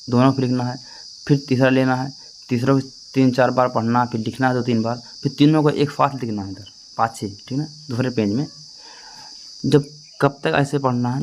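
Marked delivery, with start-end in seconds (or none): none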